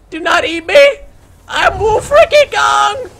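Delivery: high, loud terrified yell; panicked scream. Real, not acted. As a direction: scream for your life. Panicked in-game cry.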